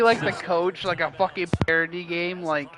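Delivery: usual speaking voice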